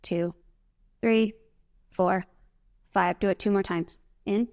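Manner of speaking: pace them through each breath; calm breathing coach; guided breathing count. Shown as a demonstration. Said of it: "Breathe out slow".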